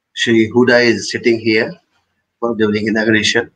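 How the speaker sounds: usual speaking voice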